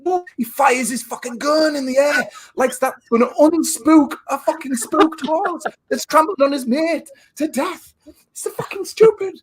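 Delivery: high, exasperated rant about the film scene; climbing into disbelief, voice way up; incredulous rant. Real, not acted. Mocking the movie to his co-host, high incredulous rant.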